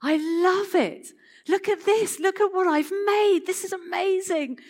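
proud maker showing off his work, thrilled; God thrilled with creation, high and loud; delighted God. Pretend. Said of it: "my rather non-academic translation".

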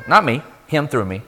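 ordinary delivery, nothing marked

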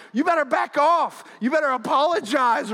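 high, loud retold outburst; yelling back angrily. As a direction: re-enact a heated demand for an apology. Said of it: "I started to yell back".

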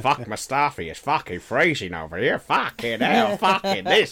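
hammed-up bad accent, imitating a wankered mate swearing; Max impression, terrible accent. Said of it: "terrible accent".

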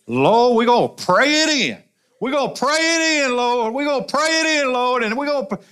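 high, fervent mock church-lady chant; old church ladies. Pastor mimicking grandmother's church ladies, high and fervent. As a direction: imitate the old women praying it in. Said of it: "they'd be all".

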